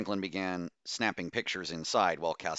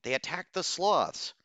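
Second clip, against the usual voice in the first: agitated; 11 semitones higher, 3 dB louder than usual. Acted. Agitated protest.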